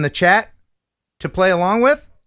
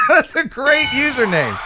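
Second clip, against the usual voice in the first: high laughing delight; 8 semitones higher than usual. Real, not acted. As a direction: laugh while praising it. Cracking up at username.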